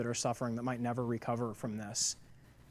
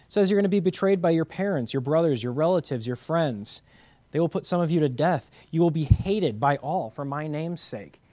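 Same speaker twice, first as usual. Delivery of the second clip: loud, higher, pounding preacher cadence; full-force preaching; 4 semitones higher, 6 dB louder than usual. Acted. Pastor preaching Luke to his church, loud and fervent. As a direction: thunder the scripture at the congregation.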